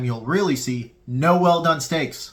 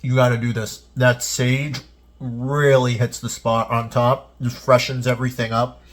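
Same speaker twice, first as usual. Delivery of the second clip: talking through a mouthful of steak; mouth full; 3 semitones lower than usual. Real, not acted. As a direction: speak around a bite of food. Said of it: "Sorry, I'm talking with my mouth full".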